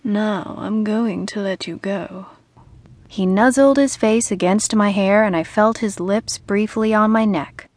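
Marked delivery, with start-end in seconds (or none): 0.0-2.3 soft, slow murmur. A narrator's reading. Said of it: "Ian murmured".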